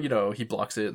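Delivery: normal talking voice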